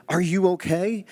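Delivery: high, alarmed call of a worried wife. Acted. Wife runs outside to her fallen husband, alarmed. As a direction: rush out calling up, worried he's hurt.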